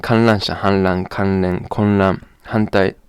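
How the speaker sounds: ordinary delivery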